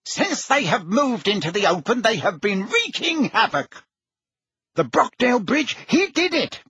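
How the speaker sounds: high-pitched, agitated torrent of news; increasingly rapid. A narrator's reading. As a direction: pour it out urgently without letting up. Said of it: "speaking more and more rapidly".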